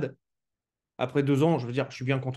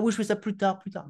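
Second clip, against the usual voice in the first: higher-pitched mock putting-it-off voice; procrastinateurs imités; 7 semitones higher than usual. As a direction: lightly imitate people brushing it off till later. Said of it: "tous les gens qui me disent". (imitation aiguë de ceux qui remettent à plus tard)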